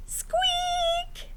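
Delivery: tiny squeaky mouse sound; high mouse squeak. Acted. Mouse noise in story.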